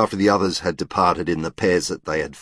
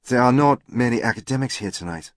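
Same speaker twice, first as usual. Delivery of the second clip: put-on higher French-accented English; 5 semitones higher than usual. A narrator's reading. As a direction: voice her as a Frenchwoman speaking English. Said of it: "in an attractive French accent".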